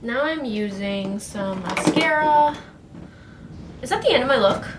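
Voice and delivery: ordinary delivery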